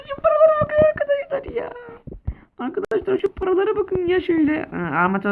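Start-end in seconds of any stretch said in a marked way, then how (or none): 0.1-1.7 much higher, louder squeal stretching paralara; giddy squeal. Real, not acted. Gloating over stolen money. 2.6-4.6 higher excited voice, less shrill, paralara leaned on. Real, not acted. Repeats gloating, calmer.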